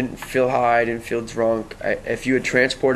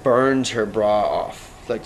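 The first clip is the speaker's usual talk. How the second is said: normal talking voice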